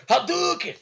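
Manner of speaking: high, mock fighting-game battle cry; attack shout. Dragon spamming moves at the meeting, high mock battle cry. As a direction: yell it like a game move. Imitating anime attack call.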